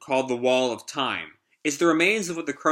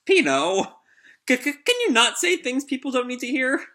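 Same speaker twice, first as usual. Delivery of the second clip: put-on high, stammering embarrassed girl voice; flustered Rebecca; 11 semitones higher than usual. Rebecca scolding Pino for embarrassing her, high and flustered. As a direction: squeak it out, mortified and stuttering.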